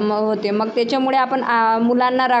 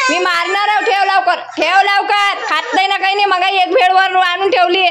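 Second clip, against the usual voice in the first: scolding threat; 8 semitones higher, 4 dB louder than usual. Real, not acted. Mother threatening and scolding child.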